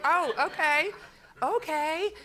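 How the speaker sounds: high, placating retreat, imitated; timid backing off. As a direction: back away carefully from a grouch. Staff backing off from his outburst.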